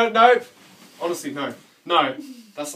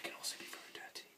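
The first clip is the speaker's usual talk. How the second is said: whispering; 25 dB quieter, faster than usual. Pretend. Teasing line whispered.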